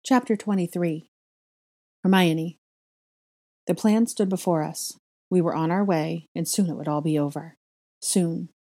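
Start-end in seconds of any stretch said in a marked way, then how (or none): 0.0-2.6 show announcer. Acted. Reader presenting a fanfic to listeners, loud announcer style. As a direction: announce the title loudly and deliberately.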